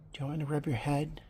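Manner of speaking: soft fast unvoiced whisper. Real, not acted. Owner whispering the head-rub offer to her cat, whisper. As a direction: hush it so the cat stays calm.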